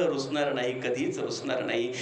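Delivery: low, soft and tearful; sobbing vow to mother; choked promise. Real, not acted. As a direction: fade the promise into grief.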